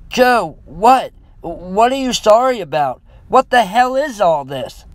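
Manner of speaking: loud, high, worried yelling; concerned yell. A narrator's reading. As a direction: yell in alarm, demanding an explanation. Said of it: "I yelled, concerned".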